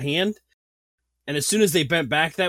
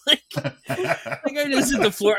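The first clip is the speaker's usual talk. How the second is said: laughing, much higher; giggling through the words; breaking up mid-sentence; 11 semitones higher, slower than usual. Real, not acted. Laughing retelling the crash.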